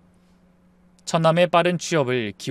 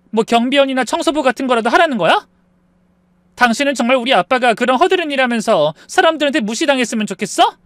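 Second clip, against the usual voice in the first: high, loud indignant protest; angry wife, voice rising; 7 semitones higher, 5 dB louder than usual. Acted. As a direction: defend your family heatedly. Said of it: "아내의 목소리가 높아지더니".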